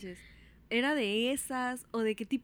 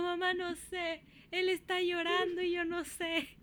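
high, drawn-out mock wail; hammed-up retold panic, pitched way up; panicked wail; 7 semitones higher, slower than usual. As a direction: whine helplessly, slow and high. Imitates own panicked answer to mother.

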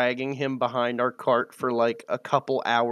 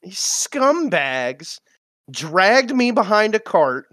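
drawn-out, higher-pitched grumbling insults; mock indignation; 6 semitones higher, slower than usual. Prisoner fuming in his head at those who dragged him, mock indignant. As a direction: play up the wounded outrage.

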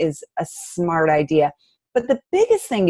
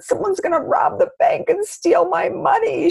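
put-on much higher, slower, a little girl's worried voice; tearful little girl; 12 semitones higher than usual. Mother imitating her tearful young daughter at the bank, high and slow. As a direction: voice her five-year-old daughter's fear, pitched way up. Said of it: "She burst into tears".

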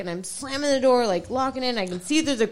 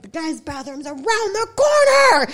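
loud, high exasperated shout; acted-out raised-voice shout; retold yell; 5 semitones higher, 5 dB louder than usual. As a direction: yell directions at a clueless drunk. Acting out yelling at drunk man.

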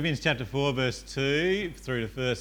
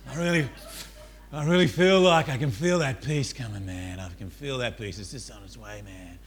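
lightly voiced mellow smoker savouring the feeling; blissed-out smoker. Smoker telling his mate he feels peace coming, lightly blissed-out. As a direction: play a hint of a blissed-out smoker.

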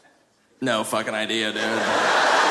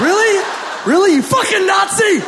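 high, loud enraged yelling; furious gamer shout; 5 dB louder than usual. Retold or imitated. Comic yelling into the headset at a German kid, furious. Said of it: "just shout in my little headset".

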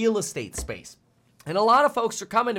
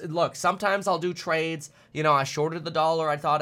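usual speaking voice

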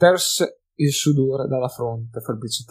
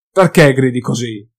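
louder, eager dwarf question; excited shout; 9 dB louder than usual. A narrator's reading. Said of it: "domandò Gotrek, tutto emozionato". (domanda eccitata e a voce alta)

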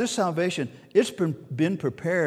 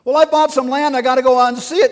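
hammed-up whiny excuse voice, louder and higher; mock excuse; 7 semitones higher, 7 dB louder, faster than usual. Preacher mimicking a guest who won't come, hammed-up excuse. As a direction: play the guest making a lame excuse. Acting out another lame excuse.

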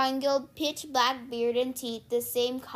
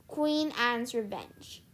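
ordinary delivery, nothing marked

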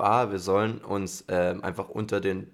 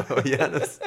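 laughing while calling the name; 6 semitones higher, 5 dB louder, slower than usual. Pretend. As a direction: say it through giggles. Laughing mock call.

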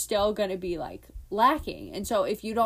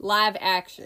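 ordinary talk

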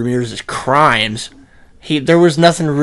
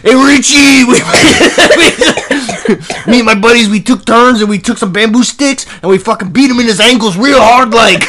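loud high New York bragging; New York accent; 10 semitones higher, 8 dB louder than usual. Retold or imitated. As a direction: boast like a rowdy New York vet. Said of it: "I made him New York".

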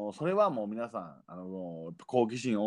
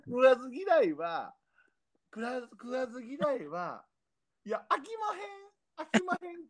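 Kyoto accent; 12 semitones higher than usual. Shown as a demonstration. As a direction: sing it out like old Kyoto. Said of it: "結構京都弁ですよ".

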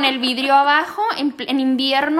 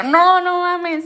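drawn-out, higher-pitched mock disbelief; retold disbelief; 4 semitones higher, 3 dB louder, much slower than usual. Friend reacting to a couple staying together, retold disbelief. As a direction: stretch the words in incredulous protest.

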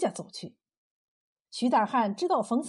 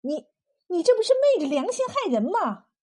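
shocked, high and loud; stammer, then burst out accusing; outraged wife; angry protest; 6 semitones higher, 5 dB louder than usual. A narrator's reading. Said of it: "她生气地说".

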